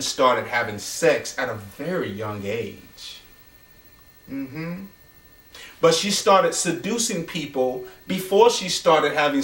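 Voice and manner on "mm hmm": ordinary delivery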